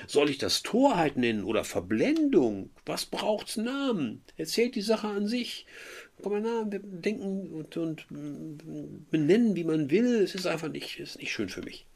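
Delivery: high, aggrieved lovesick voice; voicing Werther. Teacher voicing Werther's hurt complaint to the class, high and aggrieved. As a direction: play the wounded young lover.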